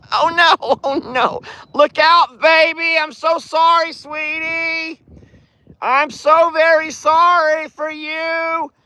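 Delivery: high cooing to the cows; sweet high voice for the animals. Real, not acted. Fussing over cows.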